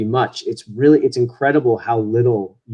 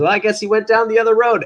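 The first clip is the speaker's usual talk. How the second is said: quick, higher put-on stage voice; stage actor giving up; 8 semitones higher, faster than usual. Actor turning away onstage, quick higher stage voice. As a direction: toss off the line quickly, pitched up. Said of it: "he goes".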